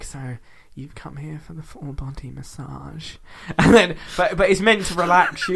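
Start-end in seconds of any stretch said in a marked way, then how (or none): 0.0-3.2 imitated ASMR role play. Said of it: "role playing and being like".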